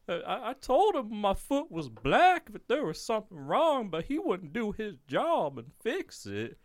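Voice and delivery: high-pitched mock inmate complaint; whining inmate. Inmate blaming the doctor for his foot, mock whine. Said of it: "he's over there like".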